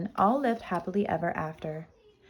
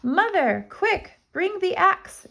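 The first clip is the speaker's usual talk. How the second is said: much higher urgent call; urgent shout; 12 semitones higher than usual. Acted. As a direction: yell for help in a rush. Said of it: "he shouted".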